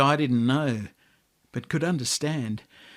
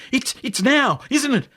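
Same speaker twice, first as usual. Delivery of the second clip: higher, eager and stumbling; eager realisation; 10 semitones higher, 4 dB louder than usual. Acted. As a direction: blurt it out, suddenly sure. Boy realises the moment to Grandad.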